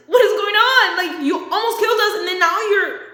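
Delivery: loud, high acted bewilderment; imitated exasperated scolding; retold outrage. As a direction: demand answers after a near crash.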